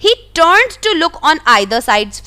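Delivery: slow, careful read-aloud of the story. A narrator's reading. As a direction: read each word out slowly for the class. Reading the English story text. (धीमी आवाज़ में कहानी पढ़ना)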